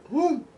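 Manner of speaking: sharp high whoo from spicy heat; burnt-tongue whoo. Real, not acted. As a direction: react to a hot bite with a whoo. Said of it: "kind of burning my tongue".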